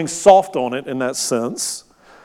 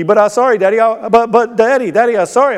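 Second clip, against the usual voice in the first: put-on high, loud, whiny child begging through tears; imitating a desperate little boy pleading with daddy; 7 semitones higher, 4 dB louder, faster than usual. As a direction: beg your dad like a crying toddler. Child pleading louder, crying.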